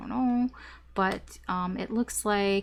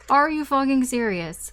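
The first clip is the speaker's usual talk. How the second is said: louder, higher mock exasperation; exasperated outburst; 5 semitones higher, 5 dB louder than usual. Reader acting out the client's angry exasperation, louder. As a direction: act out the fed-up client, punchy. Anger explodes at the crossroads.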